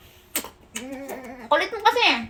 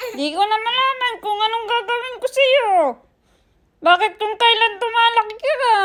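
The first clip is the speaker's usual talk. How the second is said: exasperated; 6 semitones higher than usual. Real, not acted. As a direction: wail it like a mother at her wits' end. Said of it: "Naiinis na ako!". (naiinis, mataas at pangiyak na boses)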